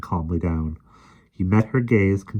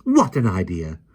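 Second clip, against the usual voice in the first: indignant nanny. A narrator's reading. Mary indignant.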